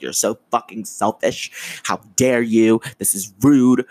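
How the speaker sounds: mock-furious, building in volume as you scold; rage texting. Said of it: "I was rage texting my sister".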